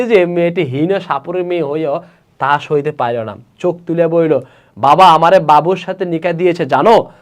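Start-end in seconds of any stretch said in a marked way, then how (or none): 4.8-7.1 acted loud outcry, rising at the end; loud cry. Girl to the intruders about her marriage, loud cry. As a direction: cry it out loudly, lifting the last word. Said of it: "তো জোর চিৎকার দিয়ে বলছে".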